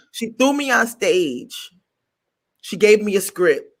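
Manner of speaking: ordinary delivery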